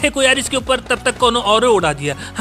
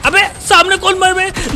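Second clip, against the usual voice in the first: sharp, slower alarmed call; sudden alarmed shout in a firefight; 6 semitones higher, 4 dB louder, slower than usual. Real, not acted. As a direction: yell at the enemy ahead. Panic during gunfight.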